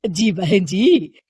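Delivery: slow, humble seller's voice; a poor hawker answering politely; vegetable seller. Acted.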